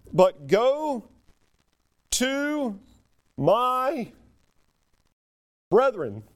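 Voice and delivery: each word set apart by pauses; slow word-by-word emphasis. Real, not acted. Preacher savouring the verse to his church, slow. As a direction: let every word land alone.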